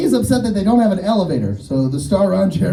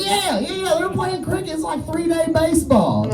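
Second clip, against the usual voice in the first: mock eager reply; 11 semitones higher than usual. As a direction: blurt it out high, as if suddenly catching on. Acting the redneck's eager reply.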